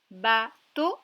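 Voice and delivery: "Bateau" loud, split ba, pause, to; syllable by syllable. Shown as a demonstration. Teacher repeats a word to locate the O, syllable by syllable. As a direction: break "Bateau" into two separate syllables. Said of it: "dans la deuxième syllabe".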